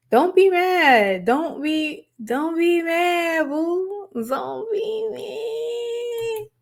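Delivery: high, slow, mocking don't be mad; teasing singsong. Taunting Sierra with a high slow teasing coo.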